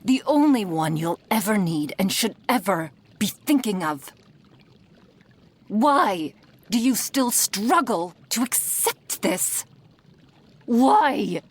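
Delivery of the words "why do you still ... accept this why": raised, higher-pitched exasperation; exasperated demand. Acted. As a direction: demand an answer in mounting frustration. Master berates captive pet.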